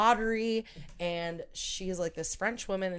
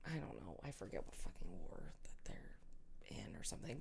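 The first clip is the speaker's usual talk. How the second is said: breathy whisper, much softer, tossed off; whispered aside; 11 semitones lower, 17 dB quieter than usual. Real, not acted. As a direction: drop to a whisper as an aside to yourself. Muttered aside about forgotten war.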